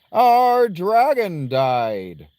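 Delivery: loud, high, very slow announcement; odd character voice. Acted. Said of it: "Why am I talking like this?".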